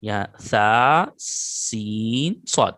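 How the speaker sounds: سا، سین، صاد said very slowly, each sound stretched; slow careful letter demo; letters drawn out slowly. Shown as a demonstration. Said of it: "وہ ہے سا".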